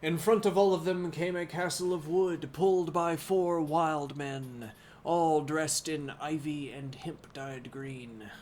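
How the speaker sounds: clear, steady reading-aloud voice; story narration. Acted. Streamer reading Don Quixote aloud to chat, in narration voice. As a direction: read the book to chat like a storyteller.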